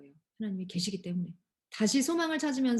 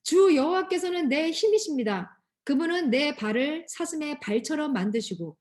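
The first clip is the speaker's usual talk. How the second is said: leading a group reading; 4 semitones higher, 5 dB louder than usual. Acted. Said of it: "시작".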